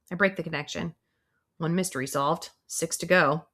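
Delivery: usual speaking voice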